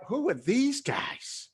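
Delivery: high, slow drawn-out question; stretched-out, high-voiced mock puzzlement; baffled wonder. Retold wonder at the gurus.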